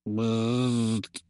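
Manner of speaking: low electronic buzz; robot buzz. A narrator's reading. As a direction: buzz like a machine. Robot buzzes.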